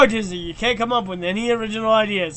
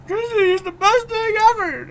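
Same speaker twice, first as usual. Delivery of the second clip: much higher, put-on gushing fan voice; mock fan; 11 semitones higher than usual. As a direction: parody a starry-eyed fan. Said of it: "They don't, like".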